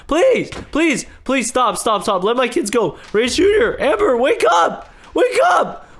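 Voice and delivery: high, panicked pleading; terrified father screaming at the attacker to stop; frantic begging. Acted.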